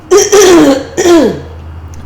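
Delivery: a sudden congested cough; chesty cough mid-sentence. Real, not acted. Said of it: "I'm congested and having issues".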